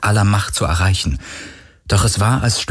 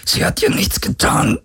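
high, mostly voiceless straining; strained protest. A narrator's reading. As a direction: squeeze the words out under torment. Tormented hero pleads with the creature. (gequält hervorgepresster Protest)

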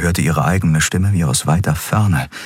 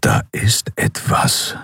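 whispered, slow warning; hushed warning; slower than usual. Acted. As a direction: breathe the words out, listening for danger. Keru senses danger, hushed. (geflüsterte, langsame Warnung)

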